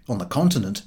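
plain reading voice

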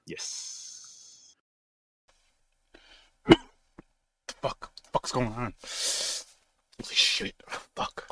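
hoarse whispers of someone turning; infected man whispering. Acted. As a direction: whisper in panic as something takes over. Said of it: "I got a little intro for you guys".

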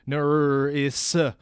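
"Nuris" drawn out, rolling through "nurr-iss-uh"; mock literal pronunciation. Shown as a demonstration. Debater mocking rival's renamed surname, "Nuris" stretched out letter by letter. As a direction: stretch "Nuris" slowly, sounding each letter. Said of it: "you wanted to change it to".

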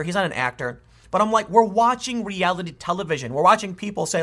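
ordinary delivery

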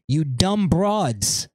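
ordinary delivery

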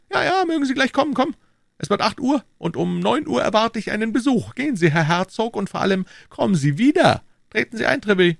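high, lofty royal voice; a king speaking down to his subjects; king's voice. Acted. King to Treville, high lofty royal voice.

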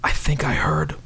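hushed conspiratorial whisper; leaning in to whisper. Acted. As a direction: whisper it close to his ear. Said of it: "So I'm gonna whisper to him".

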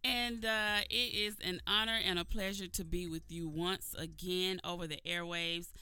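big, bright announcer welcome to listeners; radio show opener. Acted. Radio host greeting her Sunday morning listeners, booming announcer welcome. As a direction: sell the show open like a Sunday broadcast.

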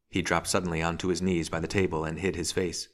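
plain reading voice

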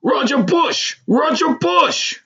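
high, drawn-out bellowed name. A narrator's reading. Man calling to old schoolmate outside store, bellowing. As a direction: holler it to catch someone across the way. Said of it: "the voice bellowed".

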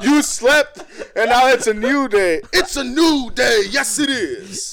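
laughing mock proclamation. Co-host hyping up the joke, laughing and slow. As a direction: proclaim it grandly through laughter.

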